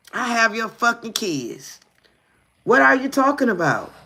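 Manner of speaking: high-pitched indignant outburst; heated mock-phone-quarrel voice; acted phone outrage. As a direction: fire it back, pitch way up. Acting out the angry phone quarrel.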